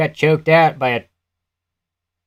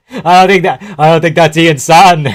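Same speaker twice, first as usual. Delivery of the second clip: a clumsy put-on Australian accent, louder and higher; bad Australian accent; 4 semitones higher, 8 dB louder than usual. Joking to friends during the fight, bad Australian accent. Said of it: "That's a bad Australian accent".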